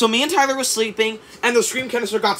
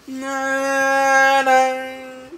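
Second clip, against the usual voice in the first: normal talking voice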